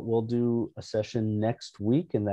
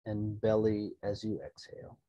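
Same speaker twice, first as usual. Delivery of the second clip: calm, unhurried meditation guide, growing softer; soft-spoken relaxation voice; guided meditation; 4 dB quieter than usual. Real, not acted. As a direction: settle listeners gently, leaving room to breathe. Leads an opening meditation.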